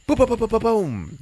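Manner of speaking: loud, high booms imitating guns; gunfire sounds. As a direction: blast out the gunshot noises.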